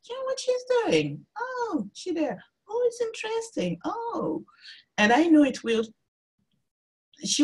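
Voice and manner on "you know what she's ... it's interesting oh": high, hushed gossiping strangers; curious onlookers. Retold or imitated. Artist mimics French strangers watching her stitch, high hushed murmur. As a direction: imitate nosy onlookers murmuring, much higher and softer. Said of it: "you can see the people watching".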